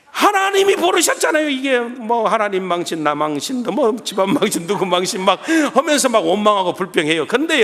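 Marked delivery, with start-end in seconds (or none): none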